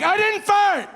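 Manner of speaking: very high, loud protest; hammed-up outraged woman's shriek; imitated shrill denial. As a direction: mimic frantic self-defence. Woman protests again, louder.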